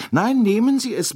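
high, bright-pitched fussing matron pressing a gift; chatty older American lady. Acted. Mrs Hubbard voiced by narrator.

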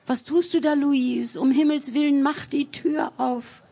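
high-pitched, alarmed begging; urgent pleading. A narrator's reading. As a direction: implore her to open the door. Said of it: "flehte um Einlass".

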